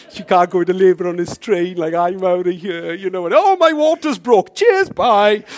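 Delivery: loud comic panic, cry on oh; panicked inner voice. Retold or imitated. Preacher acting his panic on the train, loud comic alarm. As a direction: act the frantic thought of escaping. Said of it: "I'm thinking".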